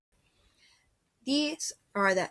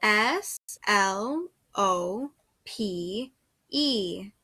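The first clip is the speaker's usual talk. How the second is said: slow spelling; much slower than usual. Shown as a demonstration. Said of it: "slow spelling for dictation".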